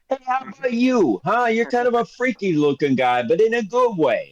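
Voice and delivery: high, friendly merchant voice; chummy shopkeeper. Acted. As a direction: charm the strange customer. Shopkeeper greets party.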